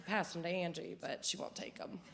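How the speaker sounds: normal talking voice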